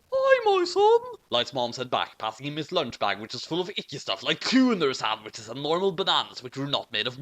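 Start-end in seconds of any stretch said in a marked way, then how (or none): 0.1-1.0 much higher, louder falsetto mom; high falsetto mother. A narrator's reading. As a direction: squeak it like a cartoon mother. Mom voiced in story.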